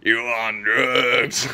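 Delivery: put-on slow, drawn-out old grandpa voice; put-on grandpa drawl of disapproval; grandpa impression. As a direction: imitate a grandfather, dragging out the words. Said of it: "he sounds like this".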